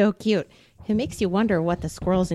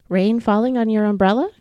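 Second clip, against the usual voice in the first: put-on reading to children. A narrator's reading. Librarian reading a bedtime book to kids, gentle. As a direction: read slowly and tenderly to a child. Reads picture book aloud.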